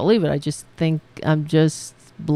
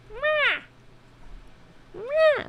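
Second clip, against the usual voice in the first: very high, short mock meow; imitated cat yell; 4 dB quieter than usual. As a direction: squeal like a demanding cat. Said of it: "like, yelling at me".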